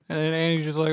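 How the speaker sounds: usual speaking voice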